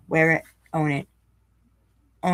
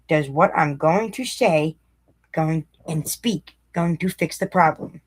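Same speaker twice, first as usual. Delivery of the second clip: slow, deliberate reading. Shown as a demonstration. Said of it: "listen closely and really process this".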